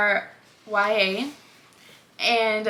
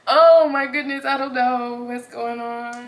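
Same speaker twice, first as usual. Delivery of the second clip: louder, laughing sob on the first words; real tears breaking through, half laughing; about to cry; 6 dB louder than usual. Real, not acted. Overwhelmed while reviewing a book, laughing and crying. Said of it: "'Cause I'm about to cry".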